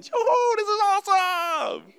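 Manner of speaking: very high, loud whoop of delight; thrilled kids. Acted. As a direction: squeal like excited kids on a thrill ride. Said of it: "My kids would be going".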